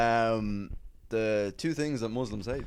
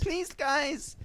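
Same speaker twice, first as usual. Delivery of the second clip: a hint of a meek security guard, pitched up; timid staff plea. Unthreatening security asking rowdy lads to stop, meek plea. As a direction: plead weakly, no authority. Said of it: "most unthreatening staff".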